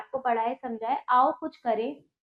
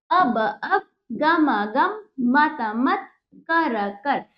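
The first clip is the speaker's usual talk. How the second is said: word by word reading. Shown as a demonstration. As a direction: spell it out for children, doubling every word. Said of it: "teacher models each word twice". (हर शब्द दो बार, रुक-रुक कर)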